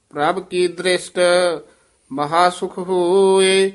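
measured, level intoned Gurbani chant; scripture recitation. Acted. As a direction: intone each phrase evenly, drawing out the endings.

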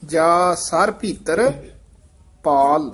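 slow ਜਾ ਸਾਰ ਭੀਤਰ, a held break, then a firm ਪਾਲ; slow ਜਾ ਸਾਰ ਭੀਤਰ, then ਪਾਲ after a break; pause before ਪਾਲ. Shown as a demonstration. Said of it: "teacher demonstrating the pause".

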